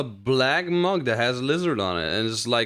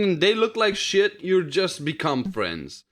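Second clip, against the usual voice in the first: ordinary talk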